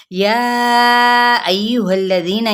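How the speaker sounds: या drawn out for four counts; tajweed demo. Shown as a demonstration. Teacher showing students the long madd, stretched slowly. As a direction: stretch या long before अय्युहल्लज़ीना. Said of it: "चार हरकत के बराबर खेलते हुए".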